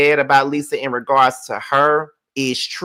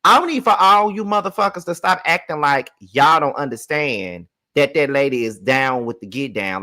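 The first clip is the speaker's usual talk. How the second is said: ordinary talk, nothing marked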